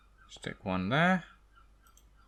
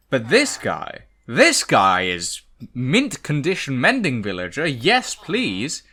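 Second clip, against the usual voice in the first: excited; 6 dB louder than usual. Real, not acted. Delighted by villager.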